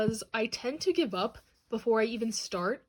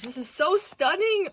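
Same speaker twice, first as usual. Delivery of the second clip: slow, higher, louder gasp of awe; overwhelmed delight; 6 semitones higher, 5 dB louder, slower than usual. Real, not acted. Vlogger gushing over a new art book, slow and awed. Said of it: "Oh, I'm gonna cry".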